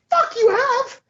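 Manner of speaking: high put-on voice calling Yoda out; incredulous high mock rant. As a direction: scold Yoda in a strained squeak.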